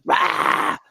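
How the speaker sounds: hoarse, voiceless roar of noise; breathy mock yell; mock family screaming. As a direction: imitate a screaming crowd. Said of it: "my family was just screaming".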